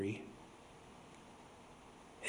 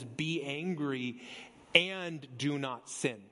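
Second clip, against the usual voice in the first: slow solemn scripture quote; slower than usual. Real, not acted. Quoting scripture slowly, solemnly.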